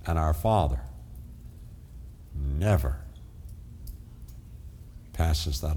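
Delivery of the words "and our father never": normal talking voice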